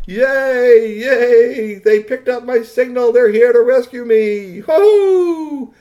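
high, loud jubilant shout; overjoyed cheering. A narrator's reading. Stranded alien hails his rescue ships, overjoyed cheering. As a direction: cheer your rescue at the top of your voice.

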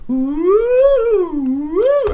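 loud high hooting woo; Sasquatch call. A narrator's reading. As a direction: hoot like a wild creature.

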